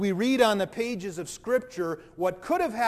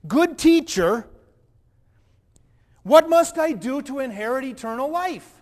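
eager plea; 7 semitones higher, 8 dB louder than usual. Acted. Eager question to Jesus.

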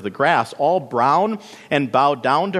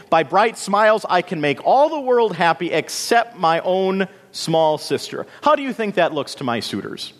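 lightly voiced vain sister, higher; vain sister Marigold, put out; 7 semitones higher than usual. A narrator's reading. Marigold complains to her sad small sister, mildly indignant. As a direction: complain in a higher, self-important voice.

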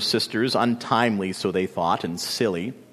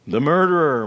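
moaning wind; 4 semitones higher, 6 dB louder than usual. A narrator's reading. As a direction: moan it like a frightened wind. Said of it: "moaned the wind".

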